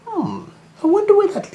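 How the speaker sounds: very high, wondering rabbit voice; small rabbit peering into a tunnel; curious little rabbit. Acted.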